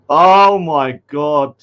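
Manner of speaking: ordinary talk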